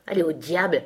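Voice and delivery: ordinary delivery, nothing marked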